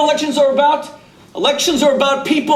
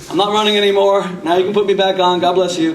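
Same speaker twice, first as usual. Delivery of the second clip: ordinary talk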